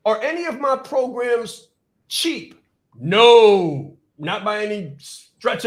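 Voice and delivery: high question, then a big loud NO; preacher-style rhetorical challenge; emphatic question-and-answer. Real, not acted. Coach answering his own question about prices, emphatic.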